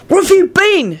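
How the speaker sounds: high-pitched scolding shout. A narrator's reading. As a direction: shout it high, demanding an answer. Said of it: "shouted William at Teddy".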